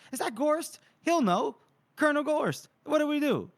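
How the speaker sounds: high, urgent put-on calls; imitated anxious soldiers. Co-host voicing soldiers seeking the colonel's orders, high mock urgency. Soldiers calling out amid pandemonium.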